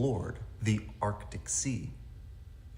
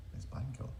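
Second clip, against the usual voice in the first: whispered; 3 semitones higher, 10 dB quieter, faster than usual. Acted. Quiet aside after the call.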